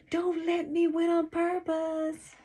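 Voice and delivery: high-pitched call to someone behind; shouting out. A narrator's reading. Racer to the brother behind, shouting out. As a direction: call out high across the distance. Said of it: "I shout out to him".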